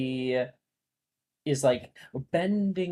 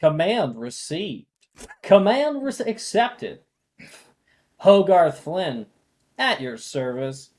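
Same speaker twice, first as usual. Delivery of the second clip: sassy; 6 semitones higher, 8 dB louder than usual. Acted. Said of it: "Say the same thing, but sassier".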